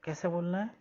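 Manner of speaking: normal talking voice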